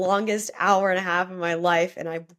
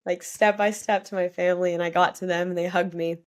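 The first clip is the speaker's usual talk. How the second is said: usual speaking voice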